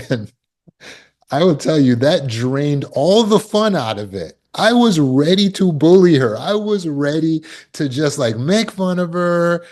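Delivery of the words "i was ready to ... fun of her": worked-up. Real, not acted. Recalling thwarted bully plan.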